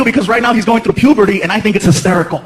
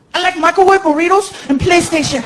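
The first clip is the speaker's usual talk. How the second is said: higher, put-on boy voice; kid voice; 7 semitones higher than usual. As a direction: answer like a simple ten-year-old. Son Frankie voiced.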